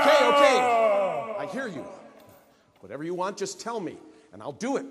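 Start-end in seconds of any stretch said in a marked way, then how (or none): 0.0-1.8 loud exasperated. Acted. Businessman gives in to ghost.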